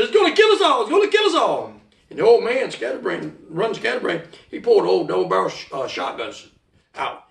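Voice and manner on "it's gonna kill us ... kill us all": high-pitched frantic wail, falling on the repeat; reenacted high, urgent alarm; panicked warning. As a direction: warn the whole room in terror. Retold panic in the bar.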